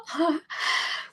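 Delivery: a breathless, unvoiced half-laugh half-sob; tearful laugh. Real, not acted. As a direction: laugh through the tears. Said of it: "I'm gonna get emotional".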